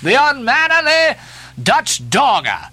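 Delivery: indignant exclamation, words stretched out; outraged cry. A narrator's reading. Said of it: "cried Stubb".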